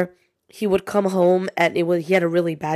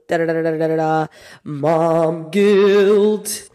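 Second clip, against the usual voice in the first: spoken fanfare, then a drawn-out title; mock drumroll announcement; 4 dB louder, slower than usual. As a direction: announce the topic with a playful build-up. Sung drumroll announcing episode topic.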